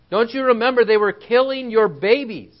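high, loud, incredulous question; exasperated challenge. Acted. Preacher confronting Hebrews who forgot their suffering, exasperated. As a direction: press the reminder home in disbelief. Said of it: "grab a Hebrew at this point and say".

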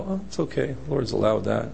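low, soft, unbothered; mock-serene acceptance, quietly let it go; calm. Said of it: "you calmly go".

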